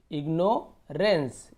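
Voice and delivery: Ignorance split as "igno - rens" with a gap; teacher sounding out ignorance piece by piece; broken into parts. Shown as a demonstration. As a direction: say ignorance slowly in two separate parts. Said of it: "ऐसे तोड़ तोड़ के पढ़ना है".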